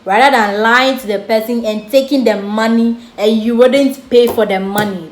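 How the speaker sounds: ordinary talk, nothing marked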